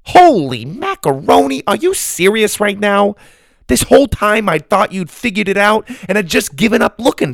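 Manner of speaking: high, agitated disbelief; flabbergasted outburst. A narrator's reading. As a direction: reel off your astonishment at him. Grandma realizes he never knew.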